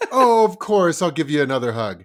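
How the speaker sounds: booming sarcastic yes of course; loud mock eager parent. Dad mocking himself caving to his kid, loud. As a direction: overplay the giving-in parent.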